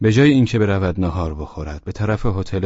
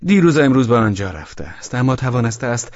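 put-on tipsy young man; 5 semitones higher than usual. Drunk young man answering Velchaninov, bewildered and high. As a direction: toss back the question, baffled.

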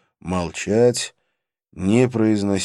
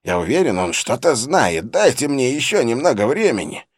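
pleading henchman voice; 6 semitones higher, 5 dB louder than usual. A narrator's reading. Danda pleads with boss.

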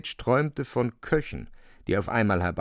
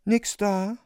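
feminine gentleness; 11 semitones higher than usual. A narrator's reading. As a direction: ask it tenderly like a woman. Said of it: "mit weiblicher Sanftheit".

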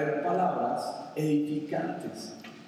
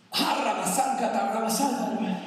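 playful mock speaking in tongues, slightly higher; 4 semitones higher than usual. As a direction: toss off some joking tongues babble. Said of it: "Hable en lenguas".

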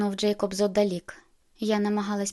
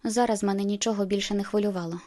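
ordinary delivery, nothing marked

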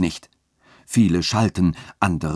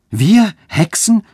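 much higher, louder, slow shriek of terror; women shrieking at the accusation; horrified cry; 12 semitones higher, 6 dB louder, slower than usual. A narrator's reading. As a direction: scream it in disbelief. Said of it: "schrien diese mit Entsetzen".

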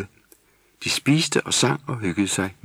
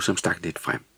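ordinary delivery

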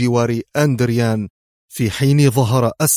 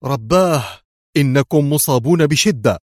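high, loud cry of dismay; raised, distressed voice; alarmed exclamation; 4 semitones higher, 4 dB louder than usual. A narrator's reading. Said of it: "يهتف في انزعاج".

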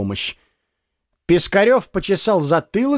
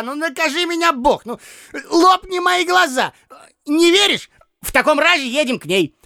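higher, frantic reassuring; old man desperately convincing his weeping friend; 11 semitones higher than usual. A narrator's reading. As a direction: swear to it, tripping over words. Piskarev swearing she is alive.

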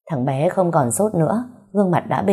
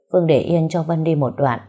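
ordinary delivery, nothing marked

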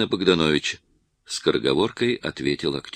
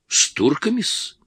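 obsequious question; 10 semitones higher than usual. A narrator's reading. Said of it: "передразнил Иванчук".

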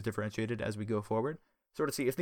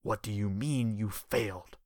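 deadly; slower than usual. Acted. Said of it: "The voice turned deadly".

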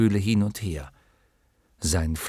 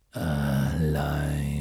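low, long growled word; growling lion voice; 8 semitones lower, 4 dB quieter than usual. Acted. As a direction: let the word rumble deep. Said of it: "wiederholte mit grollender Stimme".

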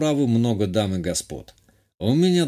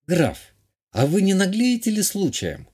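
high, teasing countess; playful mock reproach, pitched high; slightly coquettish; 8 semitones higher than usual. A narrator's reading. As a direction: chide him flirtatiously in a raised lady's voice. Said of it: "чуть кокетливо спросила Азалия".

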